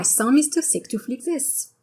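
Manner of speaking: French-accented English, th as s. Shown as a demonstration. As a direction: say "thumb" as "sum" in a French accent. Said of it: "the French accent can completely change".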